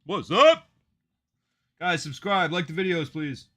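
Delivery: loud, pumped stream host greeting; loud hype call to viewers; hyped-up hello. Real, not acted. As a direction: crank the energy up for the viewers. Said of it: "Gotta get that energy up for y'all".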